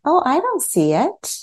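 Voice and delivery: high-pitched "oh", mock puzzlement; lightly acted student failing to spot it; puzzled follower. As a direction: raise the pitch as if missing what's shown. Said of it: "maybe it's like".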